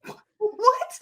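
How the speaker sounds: very high, squeaky shock; exaggerated disbelief. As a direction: squeak it out in mock astonishment. Own shocked reaction to the film, retold.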